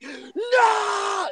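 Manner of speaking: high shouted no; mock outraged no. Mocking a Reddit fan screaming, shouted no. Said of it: "they'll be like".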